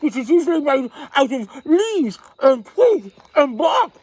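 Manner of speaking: put-on loud, high, eager kids' show explanation; excited explaining. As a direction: bubble over while showing the children. Host explains squirrels excitedly.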